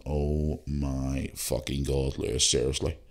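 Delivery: mock-despairing aside to Lewis; drawn-out exasperation. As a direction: drag it out, half to yourself. Embarrassed aside in Uber car.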